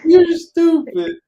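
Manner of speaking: high, loud, drawn-out slow teasing jab; mock insult. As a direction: stretch it out high and loud like a playful taunt. Teasing co-host about her joke.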